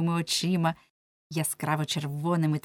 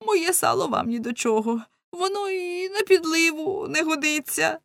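raised, whining protest; comic lament; 7 semitones higher than usual. A narrator's reading. Lamme pleading for his poor fat, high lament. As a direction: bemoan it plaintively in a higher voice. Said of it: "кричав він".

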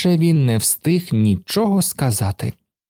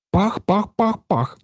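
higher imitated puffs; puffing engine; 8 semitones higher than usual. A narrator's reading. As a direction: puff like an engine speeding up. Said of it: "запахкала машина дедалі швидше". (пахкання, що пришвидшується)

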